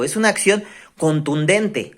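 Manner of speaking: ordinary delivery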